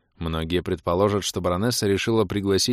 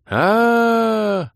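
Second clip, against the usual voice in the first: long high crowd shout; crowd cry; 14 semitones higher, 6 dB louder than usual. A narrator's reading. Crowd cry from all sides.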